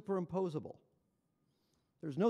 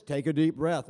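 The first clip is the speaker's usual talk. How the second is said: loud, lower machine command; machine voice prompt; 4 semitones lower, 9 dB louder than usual. Retold or imitated. Speaker imitates the device prompting the patient, machine voice. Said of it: "it says".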